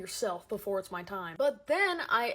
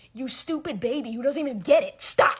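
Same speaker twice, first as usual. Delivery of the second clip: loud mock scolding; mock insult; 3 semitones higher, 8 dB louder than usual. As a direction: yell it like a bratty insult. Ranting mockingly.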